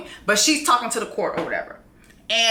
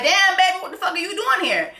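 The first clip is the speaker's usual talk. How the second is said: mock high excited; 7 semitones higher, faster than usual. Voicing king and queen reunion.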